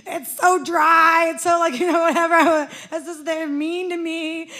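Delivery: loud, slow whiny complaint; complaining plea. Retold or imitated. Pleading with her husband to leave, loud and whiny. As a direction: whine it out, stretching the words. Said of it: "I tried to convince him".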